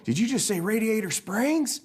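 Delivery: high-pitched surprised truck; astonished question. Acted. Mater astonished by the name.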